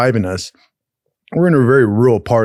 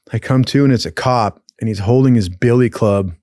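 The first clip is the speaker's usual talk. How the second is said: normal talking voice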